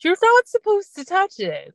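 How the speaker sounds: alarmed yelp. Retold or imitated. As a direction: squeal it like you're watching a disaster unfold. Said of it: "which I'm like".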